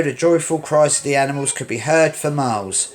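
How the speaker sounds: ordinary delivery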